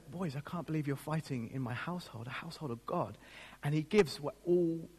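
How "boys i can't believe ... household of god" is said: quiet, soft disappointed dad; a hint of a hurt, disappointed father. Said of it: "my dad says".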